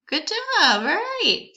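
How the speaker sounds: Good job sung out high and loud; excited praise. Real, not acted. Teacher cheering the children after they read. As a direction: cheer the class on, big and bright. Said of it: "praising the class after the reading".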